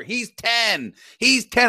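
high, insistent mock yell; retold screaming. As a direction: imitate an angry parent protesting. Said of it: "he starts screaming".

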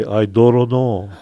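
slow Pidgin "I don't know" with "don't" rolled to "doron"; Pidgin dialect. Shown as a demonstration. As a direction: drawl "I don't know" like a Hawaiian Pidgin speaker. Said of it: "they say".

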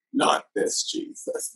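high, slow, whispered disbelief; exasperated whisper. Retold or imitated. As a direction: breathe it out in mock dismay. Dismayed reaction to crying man.

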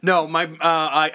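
usual speaking voice

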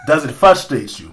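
put-on slow, teasing African accent. Host teasing an annoyed co-host, slow mock African accent. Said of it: "continue to talk like this".

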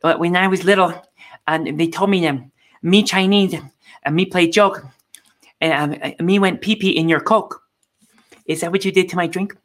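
lightly voiced rambling old woman; a hint of a doddering politician; mildly imitated Pelosi; mock Nancy Pelosi. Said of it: "she said".

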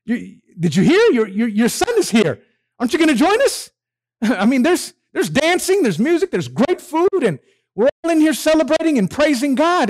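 high, eager coaxing father; excited father begging his son to join the party; father pleading. Retold or imitated. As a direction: coax the sulking son inside, bubbling over. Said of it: "and say".

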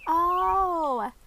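high, loud, long-drawn "oh"; light up with sudden understanding; delighted realisation. A narrator's reading. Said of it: "Maxie's eyes lit up".

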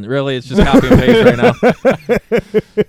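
booming, slow put-on voice; slow, booming mock declaration; loud mock proclamation. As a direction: proclaim it like a big announcement. Deadpan joke delivered loudly.

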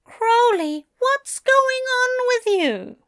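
high-pitched, exasperated worry; high, fretful challenge; concerned irritation. A narrator's reading. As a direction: demand an answer, worried and annoyed. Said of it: "between concern and irritation".

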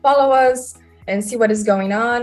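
normal talking voice

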